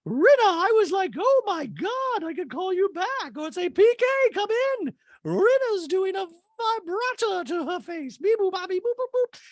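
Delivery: very high, hammed-up falsetto impression; Dorit. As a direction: stretch the words out in a piercingly high voice. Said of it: "Dorit's like".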